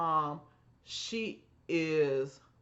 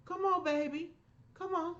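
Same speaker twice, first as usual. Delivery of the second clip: high, tender motherly voice; welcoming mom; 12 semitones higher than usual. Retold or imitated. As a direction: invite her in tenderly. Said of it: "call my mom and say".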